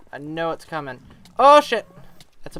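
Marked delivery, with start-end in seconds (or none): 1.4-1.9 sudden high startled yell; startled shout. Real, not acted. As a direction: shout as the danger jumps out. Blaze appears suddenly.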